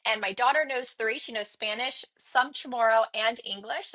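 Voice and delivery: ordinary talk, nothing marked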